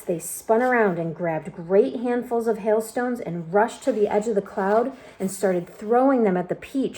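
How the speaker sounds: plain reading voice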